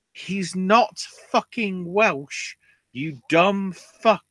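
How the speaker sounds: high, slow, furious shout of disbelief; exasperated yell. Real, not acted. Yelling at the promo writers in furious disbelief. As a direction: spit it out in outraged disbelief. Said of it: "And I'm going".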